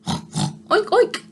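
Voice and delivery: high, breathy mock oinks; pig oinks. The two pigs in the back oinking high. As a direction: snort two little oinks. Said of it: "said Pig and Pig".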